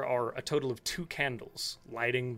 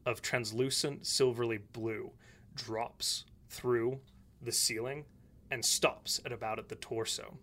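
usual speaking voice

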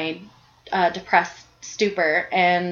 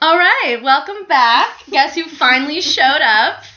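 high, loud show welcome; host welcome; 7 semitones higher, 5 dB louder than usual. Acted. Host welcoming listeners back, loud and high. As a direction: announce it to the audience.

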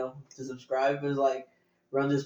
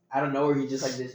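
ordinary talk, nothing marked

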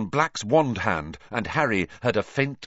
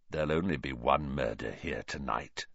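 low, soft threat; hushed, sinister killer; quiet menace; 9 semitones lower, 8 dB quieter than usual. A narrator's reading. Grinning killer threatens the children.

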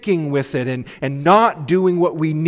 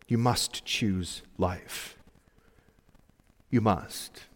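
solemn hush; 4 semitones lower, 8 dB quieter, slower than usual. Real, not acted. Solemn appeal to choose life.

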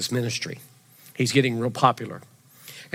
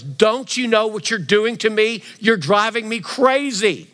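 higher, louder, slow mock-scolding mother; exasperated scolding; 8 semitones higher, 5 dB louder, slower than usual. Mary scolding twelve-year-old Jesus in the temple, loud and slow. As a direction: drag it out like a mom at her wit's end. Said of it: "she looks at him and goes".

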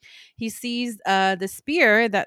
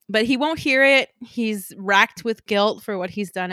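ordinary talk

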